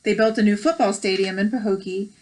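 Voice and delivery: bright storybook read-aloud; gentle picture-book narration; reading aloud to children. Acted. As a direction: read it like story time for kids.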